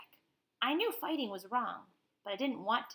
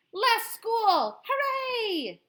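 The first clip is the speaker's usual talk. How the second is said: high, loud whoop of joy; excited cheer; 12 semitones higher, 8 dB louder, much slower than usual. Real, not acted. Child cheers at the idea of less school, loud and high. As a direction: cheer like you just got out of class.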